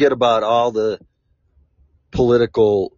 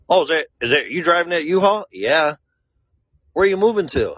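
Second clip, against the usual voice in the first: higher, fast prying imitation; mock nosy stranger; 8 semitones higher, faster than usual. He re-enacts the stranger grilling him, high fast mock voice. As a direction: rattle off the busybody's questions, pitched up.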